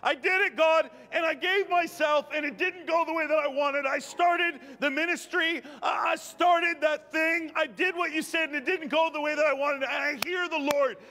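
high, impassioned exhortation; fervent preaching. Real, not acted. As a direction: preach it urgently, voicing the pleas and answers.

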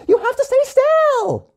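very high, drawn-out scream; screechy high demand. A narrator's reading. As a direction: scream it slowly like an exasperated kid. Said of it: "Gia screamed".

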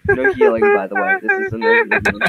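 very high squeaky mock voice. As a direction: squeak the rule out. Mock announcing rule.

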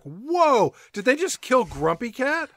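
alarmed, rising disbelief; shocked gasp. Retold or imitated. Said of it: "and you're like".

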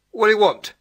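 loud, irritable demand; put-upon neighbour's sour question; dour growl. A narrator's reading. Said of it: "she growled dourly".

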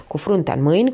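usual speaking voice

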